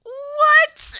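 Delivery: shocked cry to mom; shocked outcry. Retold or imitated. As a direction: yelp it like you can't believe your ears. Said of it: "And I was like".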